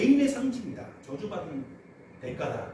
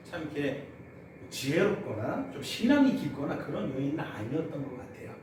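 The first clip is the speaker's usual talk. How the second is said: normal talking voice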